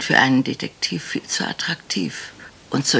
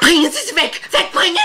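loud, high terrified command; terrified woman screaming for help; panicked shout; 7 dB louder, faster than usual. Acted. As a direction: shriek to get her away. She screams to remove the maid.

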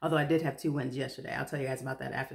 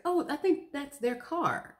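dawning surprise; 10 semitones higher, slower than usual. Retold or imitated. Realizing she took their spot.

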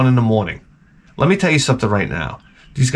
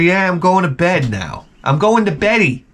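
put-on higher, childish voice; babyish mock goodbye; 6 semitones higher than usual. Host tells guests he's off to bed, childish. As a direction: say beddy like a toddler. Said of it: "I say".

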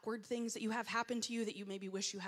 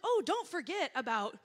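higher, louder helpful blurting; eager interjection; 7 semitones higher, 9 dB louder than usual. Retold or imitated. Woman reminding a networking friend about his wife, eager interjection. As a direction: pipe up brightly and loudly.

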